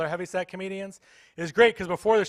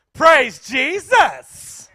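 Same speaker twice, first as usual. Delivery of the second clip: higher, very slow, louder drawn-out praise; praising church lady; 8 semitones higher, 13 dB louder, much slower than usual. Retold or imitated. Church lady one-upping in praise, drawn out and loud. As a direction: outdo the other lady. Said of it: "They go back and forth".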